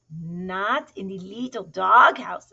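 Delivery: slow, loud, dismayed exclamation; exclaiming. A narrator's reading. Kitten hearing who took the doghouse, exclaiming. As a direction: stretch it out with punched words. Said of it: "exclaimed Scapito".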